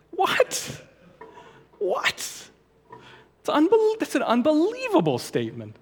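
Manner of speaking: very high squeaky disbelief; incredulous. Acted. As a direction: squawk it in astonished protest. Reacting in disbelief to commentary.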